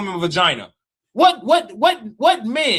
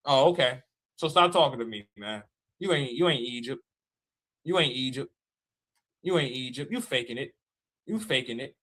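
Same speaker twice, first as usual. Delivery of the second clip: much lower and softer, brushing them off; low dismissive; 9 semitones lower, 7 dB quieter than usual. Real, not acted. Telling critics they are fakes, low and soft. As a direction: mutter it off to the side.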